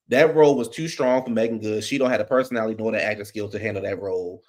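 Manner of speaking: ordinary delivery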